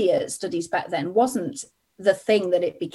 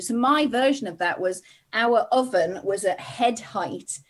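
ordinary delivery

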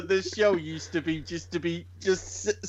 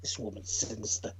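sinister low voice; 12 semitones lower, 12 dB quieter than usual. Pretend. Spooky joke voice.

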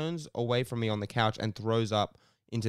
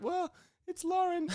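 much higher put-on girl voice, sweet and light; fake girl voice. A boy on the phone faking a girl to the dad, much higher. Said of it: "I was like".

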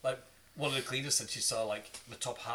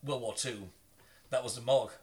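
usual speaking voice

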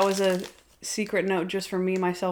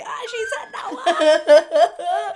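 high, loud mock squeal; re-enacted thrill; 9 semitones higher, 5 dB louder, much slower than usual. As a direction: gush through laughter, acting out the reaction. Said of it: "I was like".

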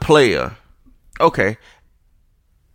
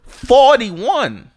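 much higher, louder outraged yell of the score; outraged shout; 11 semitones higher, 4 dB louder than usual. Real, not acted. To Rockets fans after a blowout loss, outraged shout. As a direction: blast the number out in outrage.

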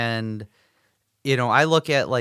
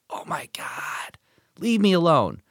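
whispered groan, then a higher fed-up plea; exasperated teen; 6 semitones higher than usual. Retold or imitated. Teen kids groaning at their parent's questions, exasperated. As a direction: sigh it out like a sulky teenager. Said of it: "these kids are just like".

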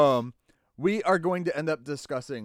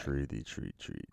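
much lower, slow and creepy; 14 semitones lower, 11 dB quieter than usual. Acted. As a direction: drop it deep and slow to gross them out. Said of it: "Don't say it like that".

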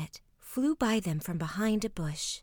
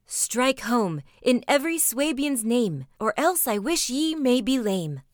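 loud, raised battle chant; rallying cry; 4 semitones higher, 7 dB louder than usual. A narrator's reading. Vetley, brave at the back, urging attack, rallying cry. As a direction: urge the charge in ringing verse. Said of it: "wanted to dash forward and cried".

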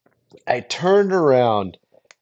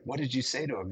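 much softer, secretive ask; hushed question; 12 dB quieter, faster than usual. Retold or imitated. Asking Mike quietly as the missionaries walk off, hushed.

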